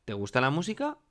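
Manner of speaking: "¿Te gusta la música?" asked with a high rise at the end; rising question intonation. Shown as a demonstration. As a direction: softly lift "música" into a question. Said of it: "yo puedo preguntar". (pregunta con subida final en "música")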